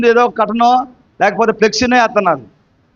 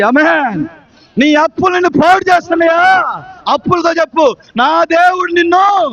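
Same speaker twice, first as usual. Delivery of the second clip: impassioned, raised sermon delivery; full-force preaching; 6 semitones higher than usual. Real, not acted. Preacher warning the congregation of trouble at home, full-force preaching. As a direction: drive each phrase out to the congregation.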